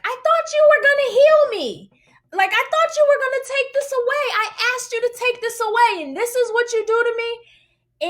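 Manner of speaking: high, strained pleading complaint; re-enacted hurt protest. Woman taking her hurt back to God, high protest. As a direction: cry out accusingly, feeling let down.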